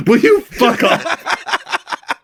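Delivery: high, slow, laughing protest. Real, not acted. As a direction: push back while cracking up. Laughing at an outrageous joke.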